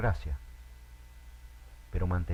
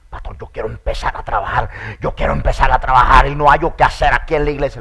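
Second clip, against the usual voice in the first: louder, fast pleading; eager churchgoer; 2 semitones higher, 13 dB louder, faster than usual. Retold or imitated. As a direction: beg to be given work. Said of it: "un hermano me dijo". (hermano ansioso y acelerado)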